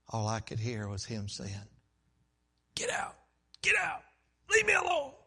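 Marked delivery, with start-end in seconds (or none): 2.7-5.1 high, strangled, building to a loud shout; agitated commands. Retold or imitated. Husband's words haunting the preacher, agitated shouting. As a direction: imitate choked bursts of rejection.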